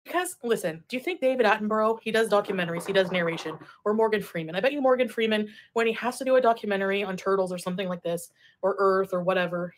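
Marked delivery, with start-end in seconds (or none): none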